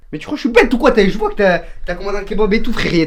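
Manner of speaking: loud indignant accusing voice; angry shouting. Acted. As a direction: call out the lie, fuming. Disbelief, accusing brother loudly.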